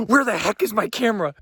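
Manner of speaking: fumbling for the phone in a rush; retold frantic scramble. Vlogger retells hunting for her camera as a moose crashes past, high and frantic. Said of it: "I was like".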